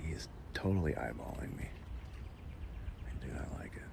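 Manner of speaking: tense whisper; whispered, wary of the snake; hushed unease. Real, not acted. Snake staring at him.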